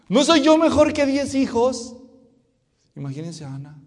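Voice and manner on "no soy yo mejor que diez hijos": very loud, high-pitched question; mock husband fishing for praise; hammed-up husband. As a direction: blare out the clueless question. Repeats the husband's question, acted.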